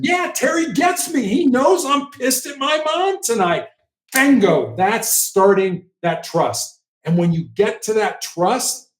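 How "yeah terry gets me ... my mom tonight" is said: much higher, relieved voice of the understood subject; angry caller who now feels understood by Terry; voicing the subject. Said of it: "It's like".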